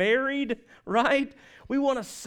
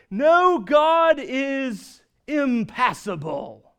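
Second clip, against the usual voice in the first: slow, loud, lofty pronouncement; pompous; 5 semitones higher, 7 dB louder, much slower than usual. Retold or imitated. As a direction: lecture down your nose. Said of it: "in a very pious and pompous voice".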